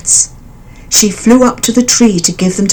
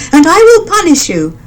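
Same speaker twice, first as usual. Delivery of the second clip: high, louder bird voice, furious; angry mother bird; 10 semitones higher, 5 dB louder than usual. A narrator's reading. Mother bird threatening the elephant, high and loud. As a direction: threaten the bully. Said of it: "she cried".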